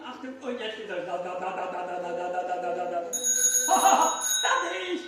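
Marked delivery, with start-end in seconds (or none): 1.1-3.1 machine noise. Retold or imitated. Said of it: "Maschine mal anmachen". 3.1-4.3 breathy laugh. Real, not acted. Trick finishes, he laughs.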